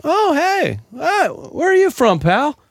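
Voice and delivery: high, eager greeting to a stranger; overfriendly American at a bar. Retold or imitated. American abroad greeting a fellow American, high and eager. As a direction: gush hello like a lonely tourist abroad. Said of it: "If you heard it, you'd be like".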